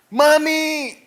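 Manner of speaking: high, drawn-out mock yell; imitated child's cry. Child calls mother who waits before helping, imitated high cry. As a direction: act out a child calling for Mum. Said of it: "Anak saya teriak".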